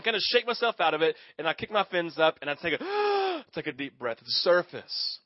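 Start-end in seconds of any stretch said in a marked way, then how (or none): none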